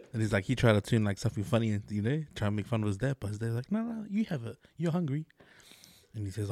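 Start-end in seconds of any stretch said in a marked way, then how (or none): 3.7-5.3 high, soft imitation of broken English. Dad giving food to his son, high, soft broken English. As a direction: mimic a dad softly insisting in broken English. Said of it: "his English is real broken".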